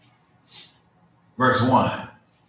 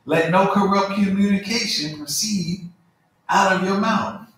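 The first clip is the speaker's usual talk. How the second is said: raised, measured preaching read; scripture reading; 6 semitones higher than usual. Acted. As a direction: proclaim the verses to the congregation. Reads scripture aloud.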